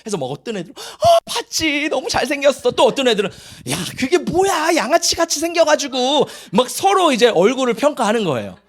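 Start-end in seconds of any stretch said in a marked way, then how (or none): 0.8-2.7 high, drawn-out mock swoon; imitated gushing. Imitating girls chatting about a handsome boy, gushing. 3.7-6.3 imitated scorn. Imitating children judging a boy's looks, scornful.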